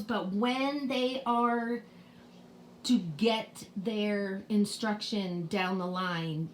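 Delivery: ordinary talk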